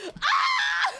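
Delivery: high sharp frustrated cry; frustrated yell. Retold or imitated. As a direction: let out a sudden exasperated scream. Frustrated outburst imitated.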